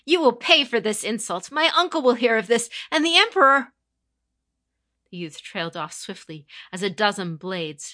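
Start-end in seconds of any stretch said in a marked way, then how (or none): 0.0-3.7 much louder, higher angry shout; furious outburst. Acted. Defiant youth shouting at the conqueror, furious and high. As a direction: spit the insult at her. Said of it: "Ichiro hissed".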